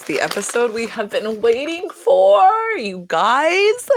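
big excited announcement to the fans; excited hype. Real, not acted. Hyping a long-awaited episode.

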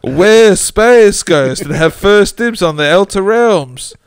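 comedic ghost voice. Acted. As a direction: ham up a cartoon ghost while cracking up. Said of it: "Well, he didn't, but".

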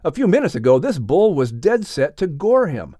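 usual speaking voice

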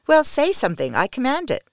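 sharp high command, pushed loud; imperious command. A narrator's reading. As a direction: order him to speak, no arguing. Wanda commands her slave.

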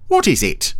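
high question, falling at the end; curious Brian. Acted. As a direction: ask eagerly in a high character voice. Brian asks about the machine.

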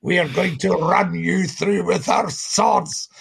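low, slow mock-menacing Viking voice; teen pretending to be a Viking warrior, low and slow; fake deep voice. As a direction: boys putting on a deep growl to sound scary. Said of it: "they'd put a deep voice on".

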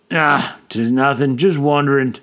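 ordinary delivery

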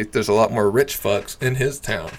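ordinary talk, nothing marked

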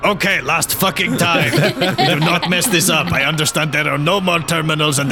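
rally the team firmly; stern pep talk. Acted. Rallying group for final attempt.

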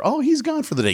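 high chirpy receptionist voice; sing-song phone receptionist voice; mock receptionist. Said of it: "I get some gal on the phone".

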